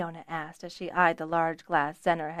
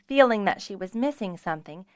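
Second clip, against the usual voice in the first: ordinary delivery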